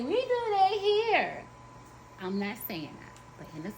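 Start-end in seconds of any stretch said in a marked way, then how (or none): 0.0-1.4 mock quoting voice. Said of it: "don't quote me and say".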